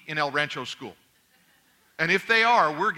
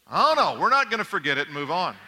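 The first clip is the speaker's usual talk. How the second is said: mock-indignant refusal; 4 dB louder than usual. Husband refusing to let wife drop the fight, mock-indignant.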